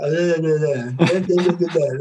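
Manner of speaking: repeated stuttered uh sounds, unable to form words; imitated jaw-broken grunts. Injured player showing the nurse he can't talk, strained grunts. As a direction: mimic trying to speak with a broken jaw. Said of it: "like this".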